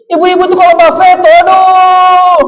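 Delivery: high, loud cry with a long-held last word; mock shouting. Preacher teasing the mothers in church, mock shouting. Mocking loud women to congregation.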